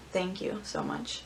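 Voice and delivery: hushed, drawn-out whisper; breathy slow whisper of thanks; whispered swoon. Acted. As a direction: whisper it slowly, in grateful awe. Swooning over stubble actor.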